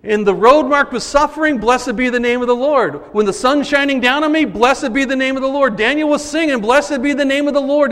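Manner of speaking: loud, high preaching cry; rising sermon fervour; preaching at full force. Acted. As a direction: proclaim it like a song of praise. Preaching Daniel's song of trust.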